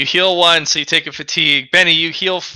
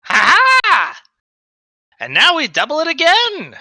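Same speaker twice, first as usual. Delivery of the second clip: high gloating villain voice; mad scientist; 13 semitones higher than usual. Acted. GM villain gloats over the players.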